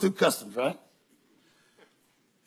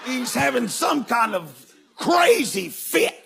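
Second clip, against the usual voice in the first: laughing shout; 4 semitones higher, slower than usual. Real, not acted. Laughing shouting about the fit.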